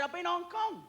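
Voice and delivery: a comic's high put-on Chinese accent; mock Chinese accent. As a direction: play the restaurant man with a put-on Chinese accent. Voicing the Chinese restaurant man.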